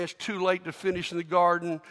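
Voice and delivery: ordinary talk, nothing marked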